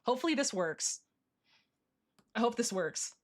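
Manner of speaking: normal talking voice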